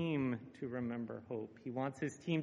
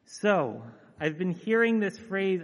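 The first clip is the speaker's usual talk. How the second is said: Ted Lasso pep talk; 4 semitones higher, 10 dB louder than usual. Acted. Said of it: "Ted says this".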